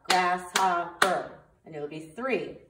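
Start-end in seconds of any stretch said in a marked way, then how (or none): 0.0-1.4 each syllable drawn out slowly; slow syllable count. Shown as a demonstration. Teacher showing students how to count syllables, slow and even. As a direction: sound out every syllable slowly. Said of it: "We always go".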